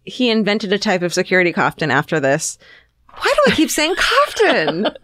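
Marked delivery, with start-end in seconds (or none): 3.2-4.9 high-pitched laughing self-mockery; amused disbelief. Real, not acted. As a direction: squeal it, laughing at your own slip. Laughs at own repeated mispronunciation.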